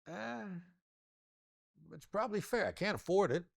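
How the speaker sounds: mock client inner voice, pitched high; imitating a hesitant client. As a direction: play the doubtful client weighing the price. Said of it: "you'll say to yourself".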